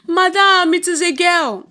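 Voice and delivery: high, loud announcement; nurse announcing. Acted. Nurse announcing to the mother, loud. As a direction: call out the news.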